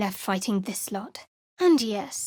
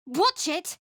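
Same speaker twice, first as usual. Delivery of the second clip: warning shout; 8 semitones higher than usual. Acted. Warning in combat.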